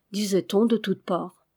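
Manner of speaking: usual speaking voice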